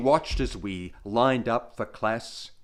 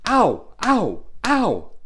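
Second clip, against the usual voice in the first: three high, loud ows; acted yelps of pain; 9 semitones higher, 7 dB louder, slower than usual. Boy slapped by teacher's ruler, high acted yelps. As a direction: yelp sharply at each slap, acted.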